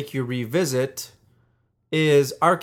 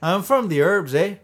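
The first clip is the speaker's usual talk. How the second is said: mock Canadian accent; 4 semitones higher than usual. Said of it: "You went Canadian for that".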